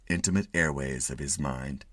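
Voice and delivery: ordinary delivery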